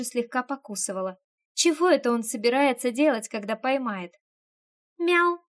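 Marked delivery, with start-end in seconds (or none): 5.0-5.4 high, loud cat meow; startled meow. A narrator's reading. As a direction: blurt out a meow. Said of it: "не выдержала я".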